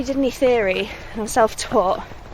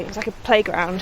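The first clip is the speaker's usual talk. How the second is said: ordinary delivery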